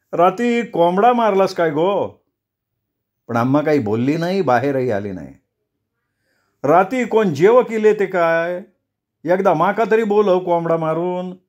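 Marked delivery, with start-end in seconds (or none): 0.1-2.2 village man asking. Acted. Sonya coaxing Amma to come out. 6.6-11.4 pestering villager. Acted. Sonya pestering Amma for chicken.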